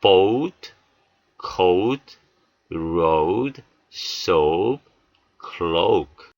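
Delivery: "boat, coat, road, soap, cloak" each on a long oh-oo glide; long O sound. Shown as a demonstration. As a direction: model "boat, coat, road, soap, cloak" slowly, one word at a time. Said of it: "다시 한번요". ("boat, coat, road, soap, cloak" 모음을 '오우'로 길게)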